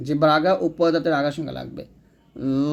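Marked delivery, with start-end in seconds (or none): 2.7-2.7 ল said full and thick, tongue tip on the upper teeth; heavy letter demo. Shown as a demonstration. Teacher modelling the thick ল. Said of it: "লটা মোটা করতে হবে".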